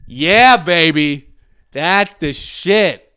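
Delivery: slow, loud, high rapture at something great; real delight. Real, not acted. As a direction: savor it out loud, thrilled. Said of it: "because this is great".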